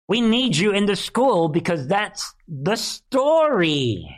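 high-pitched, hammed-up pleading; mock exasperated insistence. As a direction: insist theatrically, stretching out the final word. Handler pleading with recruit in skit.

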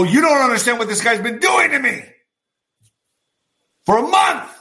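high-pitched retold protest; indignant protest. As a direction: plead your case, rising in disbelief. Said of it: "I go".

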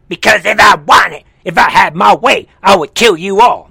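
hammed-up shouted threat; mock angry tyrant. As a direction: bellow it as a mocking threat. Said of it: "That's basically what he's saying".

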